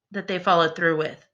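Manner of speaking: ordinary delivery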